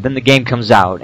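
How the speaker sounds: ordinary talk, nothing marked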